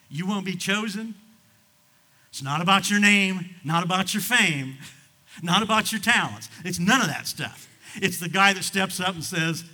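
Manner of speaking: loud, high sermon cadence; preaching shout. Acted. Pastor telling the church what chooses you, loud preaching. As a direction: hammer each line home.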